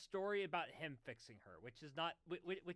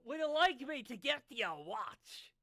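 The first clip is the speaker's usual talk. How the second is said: slow loud voice; 6 semitones higher, 9 dB louder, slower than usual. Acted. Rick's reply.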